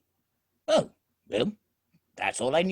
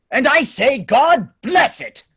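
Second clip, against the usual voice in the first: hearty loud toast; 8 semitones higher, 8 dB louder than usual. Acted. Nephew's joyful toast to Christmas.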